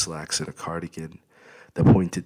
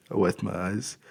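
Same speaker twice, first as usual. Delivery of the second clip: plain reading voice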